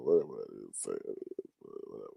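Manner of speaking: slurred, drowsy mumbling. Real, not acted. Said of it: "I probably sound like I'm drunk right now".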